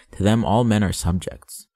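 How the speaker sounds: ordinary delivery, nothing marked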